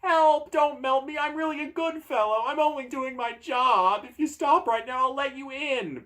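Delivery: very high, panicked pleading; high-pitched frantic door, starting on a loud cry; begging. Acted. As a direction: beg for your life in a squealing high voice. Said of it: "begs the door".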